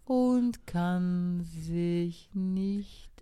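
slow, loud and lazy snail; sluggish snail. Acted. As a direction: drag it out, ignoring the hurry. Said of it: "mit ihrer gewohnten Trägheit".

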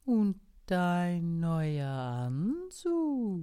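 slow snail. Acted. Snail asks slowly.

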